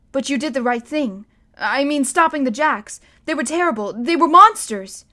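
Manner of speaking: put-on a boy insisting, voice swelling on monsters; passionate boy. A narrator's reading. Bod protests his guardian's past.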